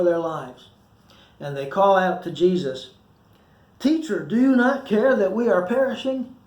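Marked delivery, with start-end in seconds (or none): 3.8-6.3 high, pleading cry with a scolding edge; half panic, half reproach; desperate plea. Acted. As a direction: call out to him over the storm. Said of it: "It sounds like a rebuke".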